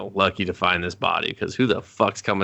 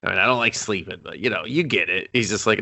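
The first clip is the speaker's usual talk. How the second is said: ordinary talk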